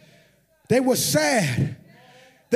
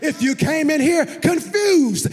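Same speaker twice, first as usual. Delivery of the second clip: full-force preaching; 3 semitones higher than usual. Real, not acted. Preacher calls the heavy-hearted congregation to arise, shouting.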